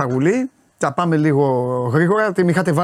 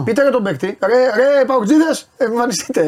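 raised, higher-pitched rallying call; 7 semitones higher than usual. Real, not acted. Host appealing to PAOK fans to appear, calling out. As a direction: summon absent listeners to speak up.

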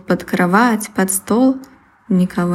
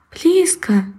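anxious mouse; 8 semitones higher than usual. Acted. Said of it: "заволновался мышонок".

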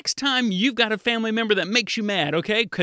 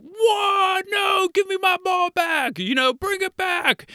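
imitating an indignant older brother, high-pitched yell; mock outraged shout; 13 semitones higher, slower than usual. Dad imitating his son whose ball was taken, outraged yell. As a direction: yell it high and drawn out, playing the wronged brother. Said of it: "then Zachary's like ... it gets a little animated there".

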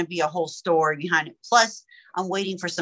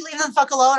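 mild mock snap; 6 semitones higher, 4 dB louder, faster than usual. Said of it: "somebody tells me".

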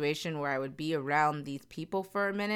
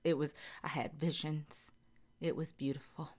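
hushed whisper; 8 dB quieter than usual. Real, not acted. Recalling her dream, hushed.

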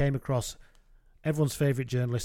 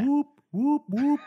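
high rising siren whoops; imitated police siren; 12 semitones higher, slower than usual. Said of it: "sounds like a police siren approaching".

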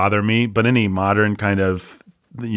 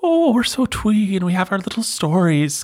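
high, put-on twee voice; sing-song parody of twee folk tales; mock twee Celt; 13 semitones higher than usual. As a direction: gush like a cosy folk storyteller.

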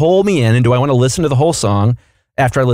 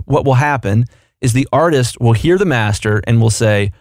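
normal talking voice

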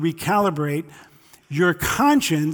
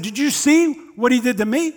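a hint of a defensive driver, higher; mock outrage, pitched up; indignant road-rage driver; 6 semitones higher than usual. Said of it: "And he would have said".

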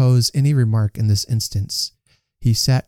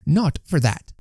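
higher, emphatic wife's voice; energetic protest; 5 semitones higher than usual. A narrator's reading. Wife rebuking Tugby over the workhouse, energetic and high. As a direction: protest firmly in a raised pitch. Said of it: "said Missus Tugby with great energy".

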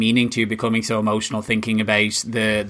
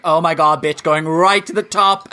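louder, higher, hyped-up praise; excited gasp; 6 semitones higher, 5 dB louder than usual. Retold or imitated. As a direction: gush over the new frontrunner. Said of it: "everyone's like".

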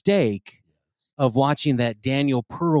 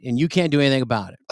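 fast defiant son; 3 semitones lower, much faster than usual. Acted. Son defiant in read story.

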